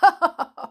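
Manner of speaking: high loud laugh; loud chuckle. Acted. Sammy laughing at the trick, loud chuckle. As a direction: burst out chuckling. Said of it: "chuckling loudly".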